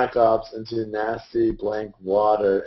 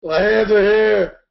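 loud protest; 9 semitones higher, 6 dB louder, slower than usual. Real, not acted. Defending against hand up call.

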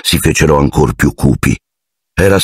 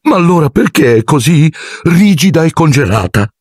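high, pleading wail; distraught high voice; distraught caretaker; 12 semitones higher than usual. Acted. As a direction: beg for an answer, near tears. Filch distraught over his cat.

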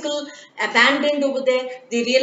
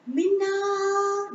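faint far-off call; 10 semitones higher, 4 dB quieter than usual. Acted. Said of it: "really hear someone call out her name".

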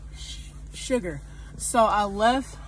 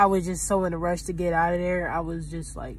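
ordinary delivery

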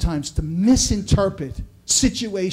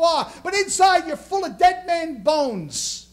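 full-force preaching; 7 semitones higher than usual. Acted. Pastor preaching to the congregation, full-force sermon. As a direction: lift the room, hammer each phrase like a revival preacher. Rails against religious Pharisees.

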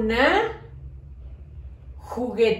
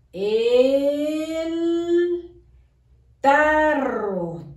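El, a long pause, then tarro louder; loud dictation; 4 semitones higher, much slower than usual. Shown as a demonstration. Teacher dictating to pupils, loud and with a pause. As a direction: call out El, wait, then hit tarro loud. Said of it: "A la hora de que yo hago pausa".

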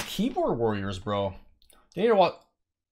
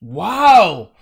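much louder exclaim; loud mock wow; 2 semitones higher, 13 dB louder than usual. Real, not acted. Streamer reacting to a player's chat reply with a loud wow. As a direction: blurt a big sarcastic wow.

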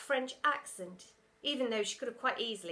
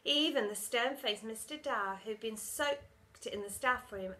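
ordinary delivery

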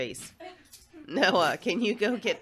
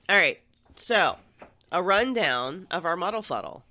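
louder, bright announcing tone; 2 semitones higher, 7 dB louder than usual. Real, not acted. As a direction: project to the room. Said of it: "A bit loud".

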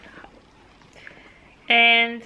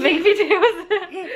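laughing echo, higher; 7 semitones higher, much slower than usual. Real, not acted. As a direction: repeat it through a laugh. Mother repeats laughing.

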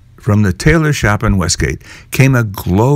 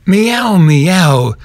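cat meow; 8 semitones higher than usual. Acted. Simpkin the cat interrupting at the door, meowing. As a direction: meow to be let in. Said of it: "interrupted Simpkin".

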